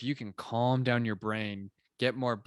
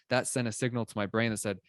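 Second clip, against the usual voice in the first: ordinary talk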